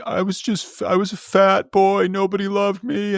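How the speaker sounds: high, whimpering mock sob of a grown man; imitating a man crying about his childhood; mock sobbing. Harvey whining to the woman about being a fat boy, mock sobbing. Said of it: "he started sobbing".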